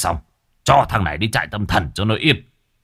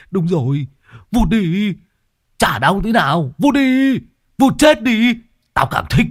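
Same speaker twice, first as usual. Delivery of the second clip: high, mocking dare to be beaten; crazed son jeering at his father, daring him to strike; defiant taunt; 8 semitones higher than usual.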